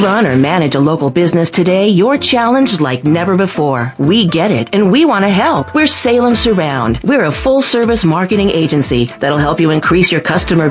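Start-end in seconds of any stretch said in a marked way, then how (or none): none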